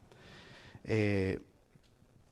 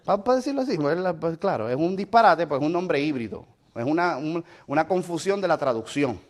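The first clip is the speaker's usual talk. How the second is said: ordinary talk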